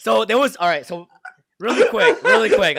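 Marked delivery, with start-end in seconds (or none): 1.6-2.8 very high, laughing squeal. Real, not acted. Laughing interruption.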